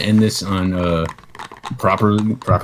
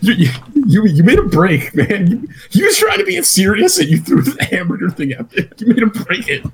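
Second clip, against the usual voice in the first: talking through laughter, pitched up; laughing; 9 semitones higher than usual. Real, not acted. Co-host laughing at the broken bit, high and giggly. Laughing while explaining the break.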